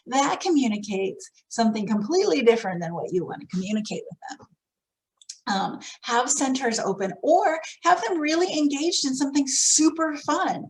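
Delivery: ordinary delivery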